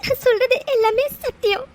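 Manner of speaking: speaking through tears, voice catching; tearful confession. Acted. Orphan telling a loved one her past, crying. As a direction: let the tears come as you confess the truth. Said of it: "அழாத".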